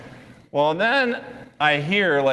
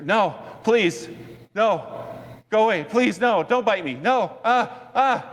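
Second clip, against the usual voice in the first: high panicked pleading, climbing higher; mock terrified pleading, pitched far up; imitating a terrified man; 8 semitones higher than usual. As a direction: beg like someone sure a dog will bite. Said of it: "I hear the man shouting".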